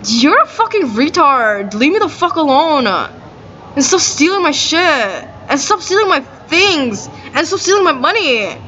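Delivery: fed-up retort without shouting; retold angry telling-off. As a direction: act out telling someone off, each demand drawn out. Retelling her angry telling-off.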